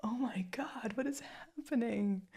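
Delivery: high, breathless laughing; helpless giggles. Acted. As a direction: lose it completely mid-sentence. She keeps laughing.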